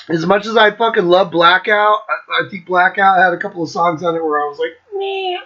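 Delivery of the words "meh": high-pitched noncommittal meh; lukewarm shrug. Acted. Fan rating weaker songs, unimpressed. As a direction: shrug it off with a high little noise.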